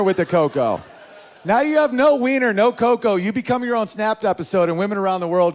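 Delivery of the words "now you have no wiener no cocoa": loud and high, punchline yell; loud, pitched-up comic outburst; comic shout. Real, not acted.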